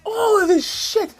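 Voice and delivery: high-pitched, incredulous exasperation; exasperated. Real, not acted. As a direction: plead in disbelief, voice climbing high. Exasperated rant at the streamer.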